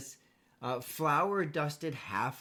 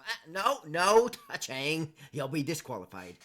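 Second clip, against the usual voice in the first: halfling warning; 2 semitones higher, 9 dB louder than usual. Acted. GM voices halfling official scolding.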